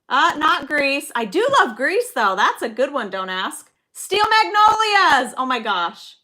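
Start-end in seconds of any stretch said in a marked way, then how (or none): 3.9-5.3 high, loud and drawn-out slow; excited announcement. Real, not acted. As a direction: call out the title in triumph. Announcing the guessed movie title.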